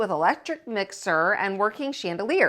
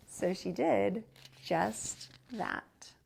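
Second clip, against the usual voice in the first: slow refrain; 2 semitones lower, 8 dB quieter, much slower than usual. A narrator's reading. Refrain read slowly.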